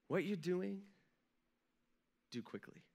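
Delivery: fading to a near whisper; soft, grave. Retold or imitated. Jesus quietly sending Judas off, grave and fading. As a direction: let the last words die away. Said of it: "just picture these words".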